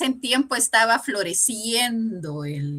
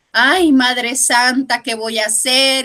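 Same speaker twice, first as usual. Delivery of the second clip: loud, slow, pleading cry to the Virgin; a lost soul begging for help, drawn out; distressed plea; 4 semitones higher, 9 dB louder, slower than usual. Retold or imitated. Woman crying out to the Virgin, slow loud pleading.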